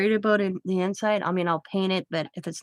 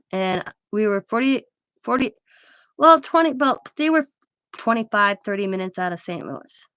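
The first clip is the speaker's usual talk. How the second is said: normal talking voice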